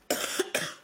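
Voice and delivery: quick dry cough. Real, not acted. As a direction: cough into the mic. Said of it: "Pardon, je tousse encore".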